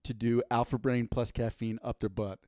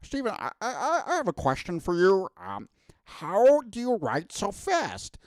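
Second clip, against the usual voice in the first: George R R Martin impression; 8 semitones higher, 3 dB louder than usual. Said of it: "that was a pretty good George R R Martin impression".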